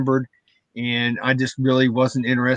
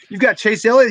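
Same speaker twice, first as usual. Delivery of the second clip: higher, amazed question; a surprised, raised ask; 8 semitones higher than usual. Retold or imitated. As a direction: turn around, startled and eager. Retold excited double take.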